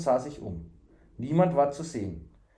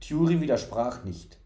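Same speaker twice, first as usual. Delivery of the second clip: ordinary delivery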